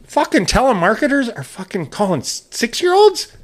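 indignant, voice climbing up; outraged disbelief. Retold or imitated. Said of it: "So now I'm like".

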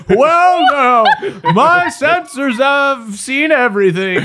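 put-on high, slow, wobbly old man. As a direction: quaver it like a kindly old robot. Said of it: "in the wobbly voice of a friendly old man".

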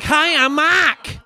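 imitating a Boston kid's hollered introduction; Boston accent. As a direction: belt it out slowly with a broad Boston accent, R dropped. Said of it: "It's a Boston accent".

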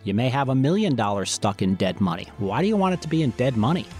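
ordinary talk

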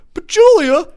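high, loud whine of "But Julia"; mock whiny protest to the computer screen. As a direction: whine it like a spoiled complainer. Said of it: "in a whiny little bitch voice".

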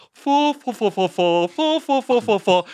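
high, silly repeated fa fa; hammed-up comic nonsense syllables; silly fa sounds. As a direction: ham up a goofy British comic's babble. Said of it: "going like".

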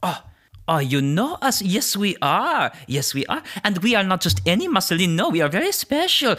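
high-pitched, delighted little creature voice; excitable tree spirit. Acted. Tree spirit tells visitors who they are, high and proud. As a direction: preen proudly in a high, swooping voice.

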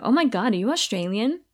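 lightly voiced excited American; a touch of a gushing American tourist; mock American surprise; imitating an American. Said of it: "people are like".

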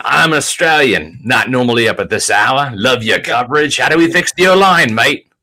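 put-on reading the super chat in a playful Australian accent; a light, hammed-up Aussie twang, ending on a rising mate; mock Australian accent. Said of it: "you already did the accent".

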